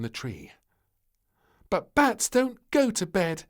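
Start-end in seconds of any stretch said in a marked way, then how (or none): 1.7-3.4 put-on high, loud little girl's protest; a small child arguing back; little princess. Little Princess arguing with the maid, high and loud. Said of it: "said little Princess".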